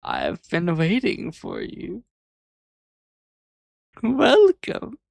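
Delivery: slow, hammed-up evil boss welcome; villain drawing out the welcome; mustache twirling villain. Repeats the villain greeting.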